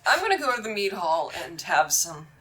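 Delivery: flustered and caught out; NPC voice. Acted. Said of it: "He goes".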